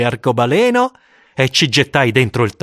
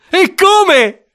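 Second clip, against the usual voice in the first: excited schoolboy; 6 dB louder than usual. Acted.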